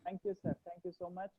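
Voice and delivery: very soft, faint thanks; barely audible. Real, not acted. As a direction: speak far too quietly to be heard. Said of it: "we can't hear you".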